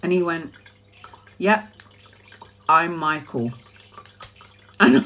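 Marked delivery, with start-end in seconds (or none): 1.4-3.5 deadpan. Retold or imitated. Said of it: "looked at me deadpan".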